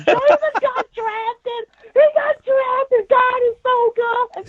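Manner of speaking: very high, slow, crying falsetto aunt; sobbing aunt. Retold or imitated. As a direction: wail it like a joyful aunt bawling on the phone. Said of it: "my auntie is just bawling on the phone".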